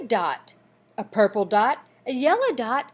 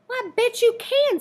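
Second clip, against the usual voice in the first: bright, much higher and quick; encouraging; 11 semitones higher, 5 dB louder, faster than usual. A narrator's reading. Girl cheerfully reassuring a shy boy he can draw. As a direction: lift it up cheerfully, like a coach.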